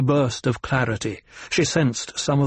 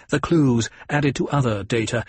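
plain reading voice

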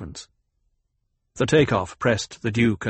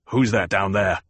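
barked; 3 semitones lower than usual. A narrator's reading. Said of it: "the Duke barked".

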